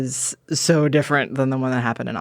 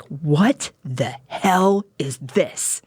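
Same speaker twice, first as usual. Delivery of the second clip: imitating an angry son; 2 semitones higher, much slower than usual. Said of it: "He was so mad".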